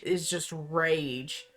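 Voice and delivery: ordinary delivery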